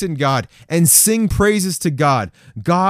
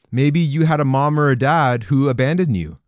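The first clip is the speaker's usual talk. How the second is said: normal talking voice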